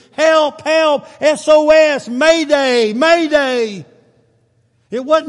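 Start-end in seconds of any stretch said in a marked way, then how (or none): 0.1-2.1 loud high cries of help; panicked shouts. Acted. Jonah shouting to God from the fish. 2.1-3.8 panicked shouts of help rising loud; desperate cry for help. Acted. Preacher voicing Jonah's panicked cry to God.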